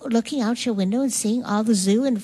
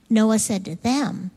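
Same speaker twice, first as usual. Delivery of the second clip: usual speaking voice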